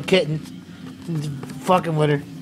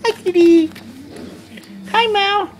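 cooing at a kitten; 8 semitones higher than usual. Real, not acted. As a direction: call to the cat in a high sing-song.

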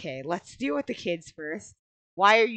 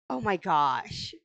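ordinary talk

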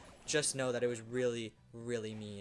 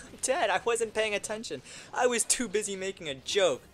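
high, loud exasperated shout; frustrated yell; 8 semitones higher, 5 dB louder than usual. Real, not acted. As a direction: yell at your own blunder. Dies while joking.

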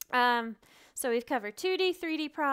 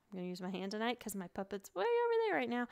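ordinary talk, nothing marked

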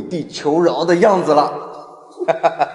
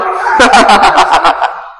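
high gloating laugh; 7 semitones higher than usual. Acted. Sycophant laughs.

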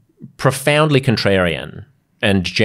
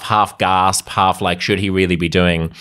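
normal talking voice

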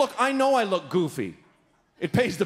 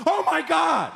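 high-pitched alarmed cry; mock panic; 9 semitones higher, 4 dB louder, slower than usual. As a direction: act out passengers thrown by hard braking. Acts passengers slammed by braking.

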